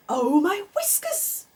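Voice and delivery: light up with a sudden idea; excited gasp. A narrator's reading. Mister Rabbit struck by a wonderful idea, crying out. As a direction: gasp then slow delighted cry. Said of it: "he cried".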